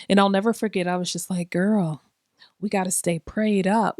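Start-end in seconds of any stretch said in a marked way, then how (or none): none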